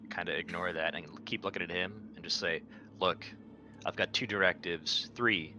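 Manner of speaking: normal talking voice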